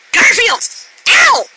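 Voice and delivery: high, loud cry of pain; exclaimed name, then a sharp ow; scolding yelp. Real, not acted. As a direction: holler at the cat mid-bite. Scolding the biting cat.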